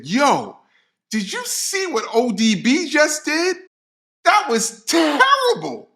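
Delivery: high, incredulous outburst; retold disbelief. DJ complains to his bandmate after the show, retold disbelief. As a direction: draw out the last word in mock dismay. Retold dismayed reaction to Chris.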